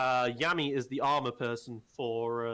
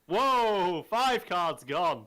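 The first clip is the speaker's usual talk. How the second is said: excited; 6 semitones higher, 3 dB louder, slower than usual. Real, not acted. Surprise at a big card play.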